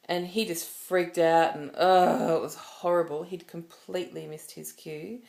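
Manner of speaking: ordinary talk, nothing marked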